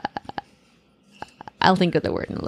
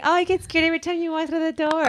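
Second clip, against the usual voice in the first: mocking; 7 semitones higher, faster than usual. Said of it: "You just made this bad joke saying".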